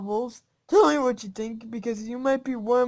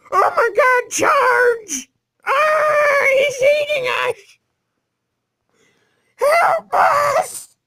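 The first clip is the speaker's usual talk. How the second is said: much higher, louder mock-panicked shrieks; panicked screaming; 8 dB louder, much slower than usual. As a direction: shriek like a fleeing mob. Voicing panicked fleeing players.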